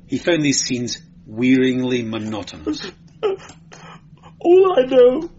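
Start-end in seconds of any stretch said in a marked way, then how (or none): 3.2-5.3 high, whimpering sob; sobbing. A narrator's reading. Beaten clerk starting to confess, sobbing. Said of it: "sobbed the clerk".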